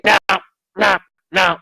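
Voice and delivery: high, in-your-face imitation of her "no"; mimicked refusal. Skeptical client rejecting evidence to the medium's face, mimicked refusal. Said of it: "Just like that, like, in my face".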